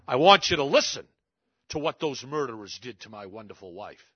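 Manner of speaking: a grieving husband bursting out, then steadier; angry outburst. Retold or imitated. Grieving widower demands to be heard.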